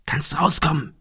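whispered call into the dark; soft call. A narrator's reading. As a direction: whisper it so only he hears. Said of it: "rief Thomas leise". (leise geflüsterter Ruf)

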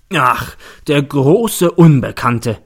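mocking. A narrator's reading. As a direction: drag it out with open mockery. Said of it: "spöttelt der Staatsanwalt".

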